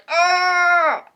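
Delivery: high, loud shocked shriek; grandma's shriek. Retold or imitated. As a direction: let out a piercing shocked cry. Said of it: "she ran out going".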